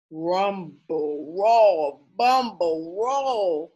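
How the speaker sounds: slow, drawn-out storytime sounds; slow, rolling read for little kids; lumbering bear walk. Acted. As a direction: stretch it out like a heavy bear. Acting out a bear's lumbering walk.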